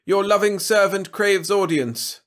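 loud, high, drawn-out plea for audience; slow ceremonial bellow; bawled. A narrator's reading. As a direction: call out grandly as a loyal servant. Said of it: "bawled Trescu".

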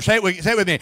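ordinary talk, nothing marked

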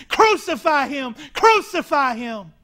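loud mob shout; crowd screaming. Retold or imitated. The crowd screams against Jesus, loud mob shout. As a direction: yell it like the crowd. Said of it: "They were screaming".

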